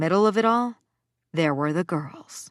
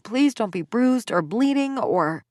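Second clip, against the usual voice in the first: higher, pleading and breathless with worry; rising panic; 6 semitones higher than usual. Acted. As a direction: let the panic climb through the list. Worried inner plea, rising panic.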